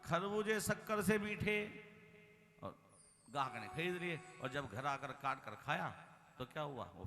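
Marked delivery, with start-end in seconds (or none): 0.0-1.8 raised, level hawker call in one run; melon seller's cry. Acted. Melon seller advertising to customers, sustained vendor cry. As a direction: hawk melons like a street vendor. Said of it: "मैं तो जोर जोर से बोल रहा हूँ".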